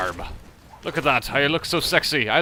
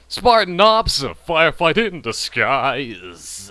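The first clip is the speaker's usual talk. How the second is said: put-on announcer repeat; much slower than usual. Gamer mockingly repeating Spartan Ops line, exaggerated drawn-out voice. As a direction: punch SPARTAN OPS, then drag out DISGUISE.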